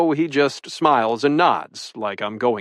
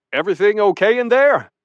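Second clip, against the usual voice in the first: calling out; 5 semitones higher than usual. A narrator's reading. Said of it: "Richard calls from outside".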